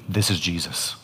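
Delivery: hushed realisation. Retold or imitated. Woman realising in her vision who speaks, hushed and low. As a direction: drop low and soft, almost under the breath.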